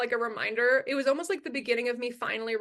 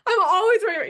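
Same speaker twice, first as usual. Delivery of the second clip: a hint of a whiny mom, rising higher and louder; mocking mom; 5 semitones higher, 5 dB louder than usual. Daughter mocking her mother's excuse about the mask. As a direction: mimic her excuse with a sneer. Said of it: "she's telling me".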